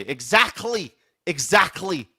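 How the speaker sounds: high, loud mock Leicester exclamation; Leicester accent. Host imitating friend from Leicester, loud Leicester accent. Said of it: "was a guy from Leicester".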